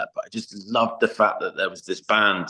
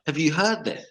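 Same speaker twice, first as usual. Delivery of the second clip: retold incredulous urging; 8 semitones higher than usual. Tour manager urging band to hear crowd, mock incredulous. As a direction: act out urging someone to listen.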